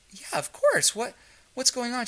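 high, startled and caring; suddenly worried, reaching out; surprised concern. Retold or imitated.

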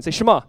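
Shema said clearly as shuh-MAH, stress on the end. Shown as a demonstration. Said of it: "Can you say the word that Shema?".

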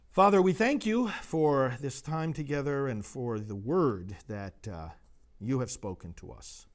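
calm, reverent prayer to God. Acted. Priest leading the congregation in prayer, reverent. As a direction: address the Father humbly.

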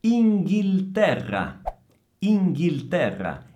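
loud, high, deliberate INGHILTERRA with a clear L; careful L sound. Shown as a demonstration. Teacher models the word for students, emphatic clear L in INGHILTERRA. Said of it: "Attenzione al suono della L".

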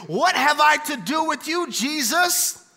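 pleading cry of the possessed man. Acted. Possessed man cries out loudly to Jesus. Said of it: "cried out with a loud voice".

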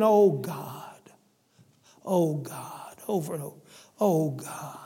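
soft, breathy, broken murmur of "Oh, God"; sobbing whisper. Retold or imitated. A repentant man weeps at the altar, whispering brokenly. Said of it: "He cried so hard".

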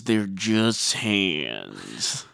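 very slow, drawn-out delivery; mock tagline. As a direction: stretch every word out, letting the last one drag. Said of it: "That's Kelly Dodd's new tagline".

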